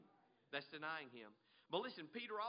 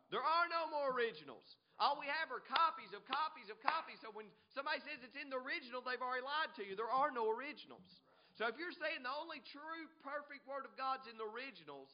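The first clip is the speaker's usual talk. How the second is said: high, loud, insistent sermon; full-force preaching; 5 semitones higher, 6 dB louder than usual. Real, not acted. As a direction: drive the point home to the congregation.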